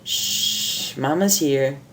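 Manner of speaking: soft, whispered shush; soothing hush. Acted. Mother soothing crying babies on her shoulder, soft hush.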